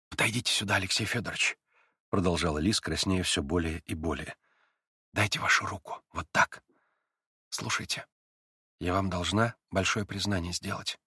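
put-on girl hushing her voice so mother won't hear; shy quiet confession. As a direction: confess it softly, blushing. Lise blushing, confessing.

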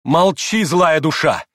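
high, loud angry command; enraged cry to silence him; furious shout. A narrator's reading. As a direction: lash out in fury. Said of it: "яростно крикнула ему Грушенька".

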